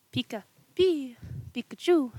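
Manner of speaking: cute creature calls; Pikachu cry. Acted. Pikachu cries.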